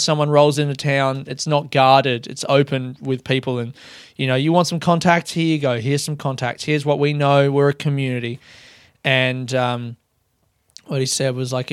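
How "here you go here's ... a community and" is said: normal talking voice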